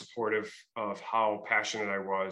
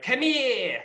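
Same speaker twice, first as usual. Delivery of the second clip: high, loud, exaggerated Rhode Island come here with the r dropped; overdone accent; 11 semitones higher, 5 dB louder than usual. Actor imitating the trooper's accent, overplayed and high. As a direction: ham up "Come here" as "come heah". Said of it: "they were a little over dramatized".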